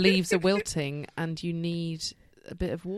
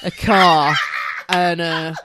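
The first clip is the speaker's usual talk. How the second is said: laughing Cockney lad impression; Cockney bloke, loud and laughing; Cockney accent; 2 semitones lower, 7 dB louder, slower than usual. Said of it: "Is that your Cockney accent".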